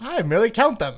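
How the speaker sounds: high hammed-up villain reply; mock villain. Friend finishing the villain parody, mock menacing.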